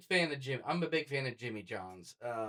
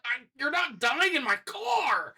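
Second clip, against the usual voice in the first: panicked yell; 11 semitones higher, 6 dB louder, slower than usual. Retold or imitated. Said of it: "I was like".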